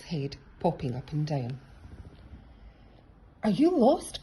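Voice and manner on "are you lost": high, loud call. A narrator's reading. Rabbit calling to Frog in the bushes. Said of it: "called Rabbit".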